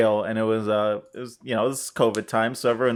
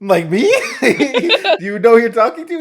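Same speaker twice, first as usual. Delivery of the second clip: laughing quote; 9 semitones higher, 6 dB louder than usual. Retold or imitated. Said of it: "I'm like".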